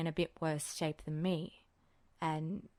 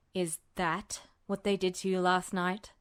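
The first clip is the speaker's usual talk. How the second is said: usual speaking voice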